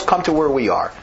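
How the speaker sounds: ordinary delivery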